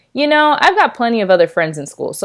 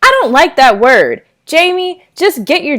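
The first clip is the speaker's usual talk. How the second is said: angry mother; 8 semitones higher, 6 dB louder than usual. Acted. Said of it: "Mom yelled".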